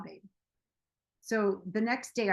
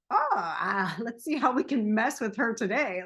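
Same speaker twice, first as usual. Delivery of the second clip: mock evil mind; 4 dB louder than usual. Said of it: "It's not like some evil".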